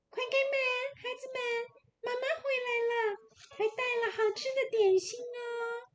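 put-on a wolf squeezing his voice thin to fool the kids. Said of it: "声音是细的".

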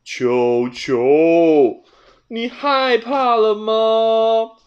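teasing. A narrator's reading. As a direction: poke fun at his fear. Teasing scared cat. (布鲁斯慢慢地取笑球球)

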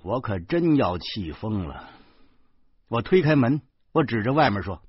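usual speaking voice

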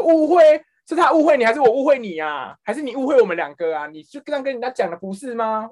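high, loud scolding interrogation; retold scolding. Supervisor grills the trainee who lied, loud and high. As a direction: tear into the trainee with questions. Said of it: "然后我就在那大骂".

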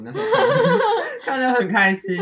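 giggling through the words; laughing. Real, not acted. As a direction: laugh while saying it. Giggling about cute guys.

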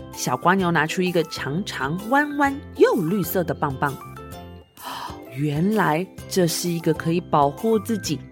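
ordinary delivery, nothing marked

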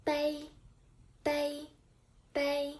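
"bei" held high and level; first tone bei. Shown as a demonstration. Teacher modelling the first tone word "bei" for learners. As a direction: keep "bei" on one high steady pitch.

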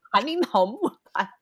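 high, laughing protest; mock outraged swearing; laughing mock outrage. Real, not acted. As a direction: laugh out an indignant retort. Mock outrage at the friend's joke.

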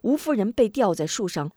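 ordinary delivery, nothing marked

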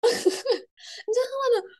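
slow words squeezed through laughter; laughing. Real, not acted. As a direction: laugh while saying it, high and slow. Laughing retelling of prank.

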